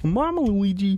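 high-pitched cartoon Italian accent; mock Italian accent. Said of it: "That's an Italian accent".

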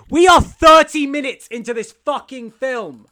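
loud, slow, high exasperated yell; exasperated rant. Real, not acted. Podcaster ranting about the film's pace, loud and slow. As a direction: blow up at how long the film takes.

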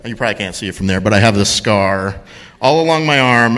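ordinary delivery

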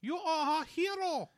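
overdone movie villain German voice; bad German accent. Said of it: "This is a bad German accent".

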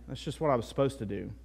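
low, soft, quick throwaway answer; quick indifferent husband; dutiful reply. Acted. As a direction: brush off the thanks as mere duty. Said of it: "That's not the way to do it".

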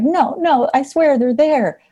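put-on pleading kid. Crying kid insists.

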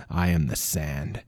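low, slow, quiet growl. A narrator's reading. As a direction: growl it low and slow, barely above breath. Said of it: "looked at the creature and growled".

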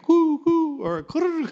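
high pigeon coo. Shown as a demonstration. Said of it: "they're cooing".

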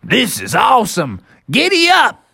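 high gleeful urging; excited Bubby. Acted. Bubby riding a triceratops, gleeful. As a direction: whoop it to your mount.

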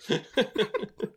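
high, helpless chuckle; laughing. Real, not acted. Friend amused by a video he retells, high chuckling. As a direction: chuckle at your own story.

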